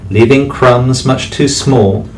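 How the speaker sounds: plain reading voice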